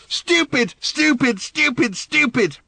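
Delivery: high, furious repeated shrieks; angry screaming. Acted. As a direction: scream each word in rage. Random screams the word.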